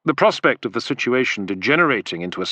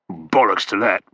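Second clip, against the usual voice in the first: growled; 2 semitones lower than usual. A narrator's reading. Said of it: "Macro growled".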